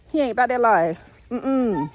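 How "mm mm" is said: ordinary talk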